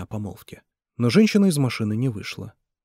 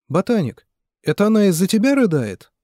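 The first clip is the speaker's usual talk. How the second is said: loud, much higher, mocking challenge; angry jeer; 9 semitones higher, 7 dB louder than usual. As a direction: hurl the question at him. Nikolai turns on Mitya. (Николай громко и зло насмехается над Митей)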